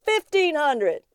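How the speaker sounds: high, drawn-out shout; screamed bid. A narrator's reading. Thompson bids desperately at auction, screaming high. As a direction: scream the figure, slow and high. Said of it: "Thompson screamed".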